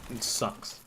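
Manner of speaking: whispered, grumbling British moan about Kent; mock British moan. Streamer imitating Britons moaning about home, in a muttered British grumble. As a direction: grumble it like a jaded Brit. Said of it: "the British way to be like".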